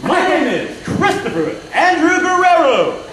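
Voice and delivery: high, slow game-show introduction; game-show announcer. Pretend. Volunteer giving his name on stage, like a game-show announcer. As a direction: announce your name grandly, stretching it out. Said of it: "do you work on game shows by chance".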